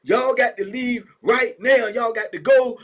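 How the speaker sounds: high, loud order to clear out; barking officer. Retold or imitated. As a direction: shout the visitors out of the room.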